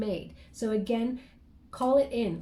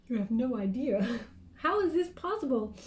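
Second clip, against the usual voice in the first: laughing. Real, not acted. Laughs at her own tiredness.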